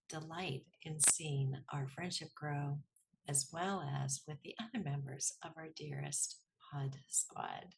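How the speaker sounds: measured, scripted host introduction; podcast intro read. Acted. Host introduces her guest to listeners, scripted intro read. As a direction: read the episode introduction evenly, pausing between phrases.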